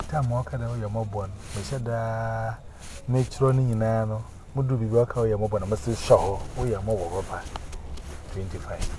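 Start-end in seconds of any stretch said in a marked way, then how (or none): none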